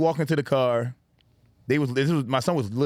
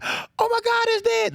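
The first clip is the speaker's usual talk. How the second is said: much higher wail of dismay; mock horrified ex-wife. Ex-wife finding the koi dead, high mock dismay. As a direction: squeal it like she can't believe it.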